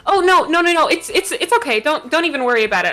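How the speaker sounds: high, quick, friendly fluster; reassuring bard character. Acted. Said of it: "starts gathering papers and goes".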